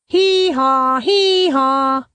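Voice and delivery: high braying hee-haw like a donkey. Acted. Burro answering Nieto with a high donkey bray.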